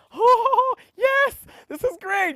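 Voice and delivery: high, loud, drawn-out delighted gasp; over-the-top thrilled squeal, retold; mock elation. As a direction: gush with exaggerated relief and joy. Retells own delight at cosy room.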